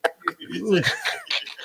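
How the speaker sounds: rising giggle; high laugh. Real, not acted. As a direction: burst out giggling. Laughing at the story.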